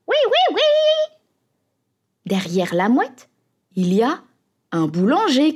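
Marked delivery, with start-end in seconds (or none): 0.1-1.2 very high, loud seagull yes; crying seagull. A narrator's reading. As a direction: screech it like a greedy seagull. Seagull chasing again.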